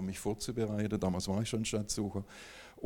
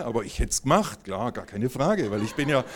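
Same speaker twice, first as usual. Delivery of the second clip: ordinary talk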